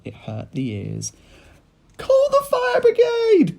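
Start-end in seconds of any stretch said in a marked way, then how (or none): 2.0-3.6 high, loud, slow panicked shout; panicked cry. A narrator's reading. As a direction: yell for help in a shrill high voice. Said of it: "cried Missus Cranky".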